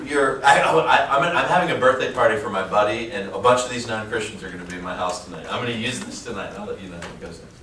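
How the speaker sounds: usual speaking voice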